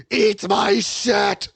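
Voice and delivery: hammed-up demonic yelling; villain's roar. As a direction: bellow the final curse at full force. Villain's final attack.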